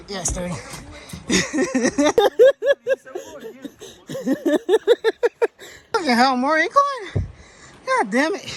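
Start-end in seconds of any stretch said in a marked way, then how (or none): 0.6-5.9 long high laugh burst; big laugh. Real, not acted. Hiker laughing at the next incline, big laugh. 5.9-8.5 higher frustrated outburst at the climb; exasperated cursing. Real, not acted. Tired hiker facing another uphill, exasperated.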